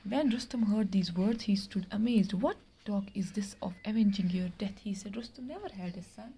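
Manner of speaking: slow, measured reading of a tale; story reading. Acted. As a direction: read each word out slowly for the class. Teacher reads story aloud. (धीमी आवाज़ में कहानी पढ़ना)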